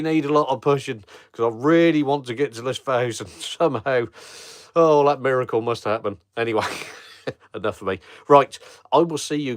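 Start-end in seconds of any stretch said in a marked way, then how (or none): none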